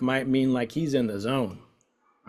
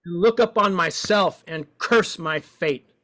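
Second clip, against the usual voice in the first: expressive woeful reading; 8 semitones higher, slower than usual. Shown as a demonstration. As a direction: give it full woe. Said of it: "we're playing with expression".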